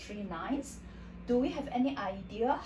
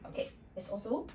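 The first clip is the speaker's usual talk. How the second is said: usual speaking voice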